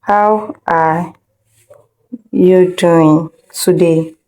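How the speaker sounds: ordinary talk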